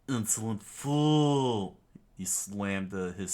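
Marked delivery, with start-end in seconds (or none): 0.1-1.8 loud, higher, drawn-out insult; villain's outburst. Acted. Villain to Luffy as he strikes, outraged shout. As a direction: bellow it slowly as a haughty villain.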